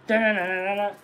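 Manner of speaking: dun dun sung like a horror sting; mock suspense tune. Telling viewers about ghosts, a playful suspense hum. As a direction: hum a spooky suspense riff.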